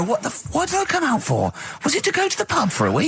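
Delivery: high, far-off put-on voice of a confused builder; mock builder voice, pitched well up. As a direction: squeak it out like a dim workman. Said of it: "He didn't come there and go".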